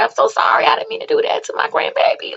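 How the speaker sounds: high, hushed whispered apology; imitating a crying grandmother. Retelling the grieving grandmother at the funeral, high whisper. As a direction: whisper it like a broken old lady. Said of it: "But she's like".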